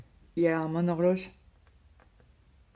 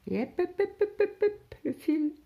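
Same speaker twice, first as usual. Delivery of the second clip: high sing-song hops climbing higher and higher; 4 dB louder than usual. Retold or imitated. Telling viewers how she gobbled her sandwich, high sing-song hops. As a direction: chirp the hops up fast like gobbling it down.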